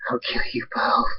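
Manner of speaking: a soft, menacing whisper; threatening whisper. Acted. Nightstorm threatening David and Fluttershy in a whisper. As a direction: breathe the threat out low.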